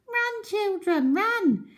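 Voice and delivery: high, slow urgent cry; monkey shouting a warning to the children. A narrator's reading. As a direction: call the children away from danger. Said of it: "Mugglewump shouted to the children".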